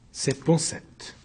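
slow, near-whispered naming; hushed naming. Acted. Witness naming the impostor before the judges, slow near-whisper. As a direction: let the name slip out under the breath.

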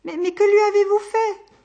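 alarmed secretary stammering in fright; shrill-pitched panic at her boss; panicked. Acted.

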